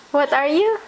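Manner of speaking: normal talking voice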